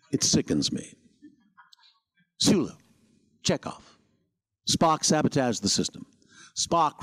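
halting, theatrical star actor impression; weary sighs, then declaimed lines broken by pauses; put-upon actor delivering each phrase with a falling cadence; William Shatner. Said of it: "I never really unlocked the Shatner voice".

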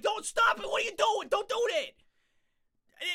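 high, frantic shouting; panicked yelling. Retold or imitated. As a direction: yell in high-pitched panic at him. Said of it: "I'm like".

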